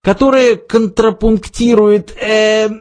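high, groping literary patter; Arthur. A narrator's reading. Arthur stalling while praising the poem, high and hesitant. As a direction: carry on high, stalling on a filler. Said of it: "продолжал Артур".

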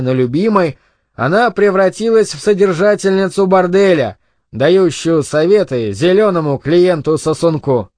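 usual speaking voice

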